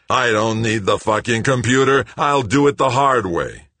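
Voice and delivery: high, loud, furious shouting; railing furiously. A narrator's reading. Greg banging his wrench on the pumps, railing loudly. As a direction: shout it in an enraged rant. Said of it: "Greg railed".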